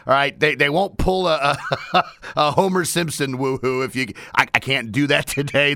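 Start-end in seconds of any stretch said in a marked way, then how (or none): none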